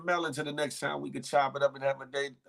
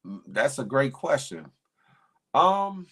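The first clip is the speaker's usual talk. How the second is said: normal talking voice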